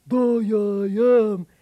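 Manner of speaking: loud, dopey giant voice; booming dull giant grunt; dim giant. Acted. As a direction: answer like a slow-witted giant.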